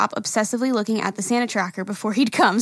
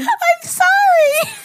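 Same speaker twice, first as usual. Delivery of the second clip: very high, loud, stretched laughing apology; 3 dB louder, much slower than usual. Real, not acted. Cohost caught skipping an email, apologizes through laughter. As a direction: apologize while cracking up.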